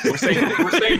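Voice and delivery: high, loud words breaking into laughter; laughing. Real, not acted. Friend announces the new word to the group, laughing. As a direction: start the sentence while cracking up.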